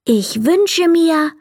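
mild call. Acted. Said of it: "rief er laut".

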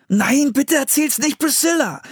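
high, panicked begging; frantic boy's plea; pleading. A narrator's reading. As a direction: beg urgently, pitch way up. Boy begs sister not to tell.

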